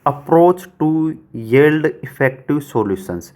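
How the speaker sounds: slow, word-by-word reading of an exam sentence; धीमा वाचन. Real, not acted. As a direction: read it out slowly in separated chunks, like dictation. Reads exam sentence aloud slowly. (रुक-रुक कर, धीरे-धीरे वाक्य पढ़ना)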